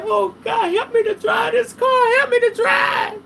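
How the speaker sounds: high-pitched, hammed-up desperate cry to God; wailing prayer. Reader re-enacts praying while sobbing at the wheel, wailing plea. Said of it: "then I gotta pray".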